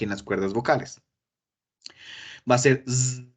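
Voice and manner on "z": z buzzed with voice like a bee; teacher showing the voiced z; buzzing z demo. Shown as a demonstration. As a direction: buzz the z through the vocal cords. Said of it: "como el zumbido de las abejas".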